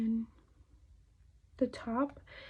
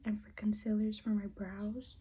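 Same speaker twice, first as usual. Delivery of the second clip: ordinary talk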